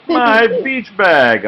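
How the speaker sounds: high, loud, drawn-out joyful cry; delighted cry. A narrator's reading. Oliver finding his lost bag, high joyful cry. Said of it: "Oliver cried".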